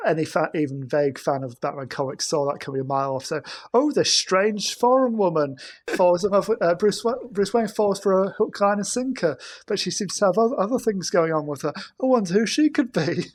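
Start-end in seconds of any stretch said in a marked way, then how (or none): 3.7-13.2 sarcastic mock narration. Mocking the predictable movie twist.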